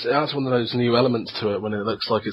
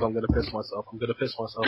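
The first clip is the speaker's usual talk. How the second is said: hushed, much softer mock panic; secret inner panic; 10 dB quieter, faster than usual. Bravado hiding fear inside, hushed mock panic. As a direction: say it under your breath, pretending terror. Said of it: "Whilst on the inside, I was like".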